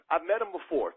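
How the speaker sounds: ordinary delivery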